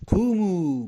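forceful qumu, urging the mourners to rise; rousing call. Shown as a demonstration. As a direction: call قوموا out as if waking a crowd. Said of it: "کلام قوی تر شد، تندتر شد نسبت به اولی".

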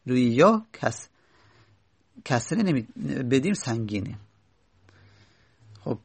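usual speaking voice

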